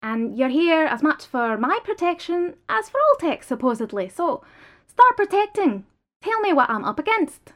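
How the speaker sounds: sharp demand. Acted. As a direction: order him to act. Prisoner commands the lawyer.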